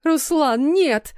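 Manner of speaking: high, drawn-out horrified cry. A narrator's reading. Mother bursting in after the gunshot, horrified cry. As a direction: cry out high in desperate horror. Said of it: "раздался её истошный вопль".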